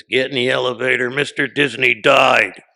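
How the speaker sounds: loud imitation of a blunt mother; mom's blunt delivery. Mom breaks news of Disney's death to her son, loud blunt delivery. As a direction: belt out the bad news like an order, imitating mom. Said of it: "that's how my mom delivers stuff".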